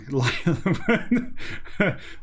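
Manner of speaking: amused giggle; short laugh at text. Real, not acted. Laughs at stage direction.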